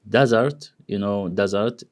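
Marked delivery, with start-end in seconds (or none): 0.1-0.7 DESert, weight on the first syllable; desert stressed at the front. Shown as a demonstration. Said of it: "the stress on da". 1.3-1.8 DESert, weight on the first syllable; desert stressed at the front. Shown as a demonstration. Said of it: "the stress on da".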